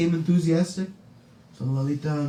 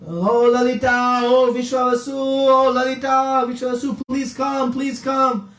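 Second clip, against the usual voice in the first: calling out; 8 semitones higher, 3 dB louder than usual. Retold or imitated. Villagers calling Lalita and Vishwavasu to come, calling out. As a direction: imitate a long call through a horn. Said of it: "They would call into those horns".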